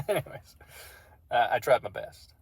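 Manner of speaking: ordinary delivery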